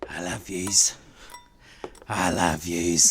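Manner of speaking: put-on low, slow drunken mumble; drunken slur. Drunk [name] slurring love to his friends. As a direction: slur it like a man deep in drink.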